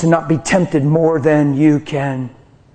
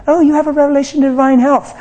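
higher, louder, fast mocking mimic; mimicking people; 9 semitones higher, 4 dB louder, faster than usual. Preacher mimicking people who praise him, high mocking voice. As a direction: parody the eager listener.

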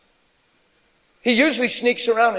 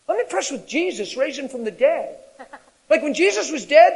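ordinary delivery

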